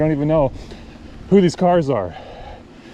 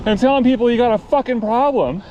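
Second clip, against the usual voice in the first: much higher, exasperated retelling of his own rant; heated retort; 12 semitones higher than usual. Retold or imitated. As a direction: re-enact the outburst at the driver, pitched way up. Retelling his heated roadside argument.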